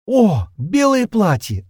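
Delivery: high, slow gasp of delight. Acted. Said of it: "ахнула она".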